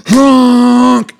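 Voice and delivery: high, loud honk noise; imitated car honk. Showing wife the honk he didn't do, high and loud honk. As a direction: blare it like a car horn. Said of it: "I didn't do like the".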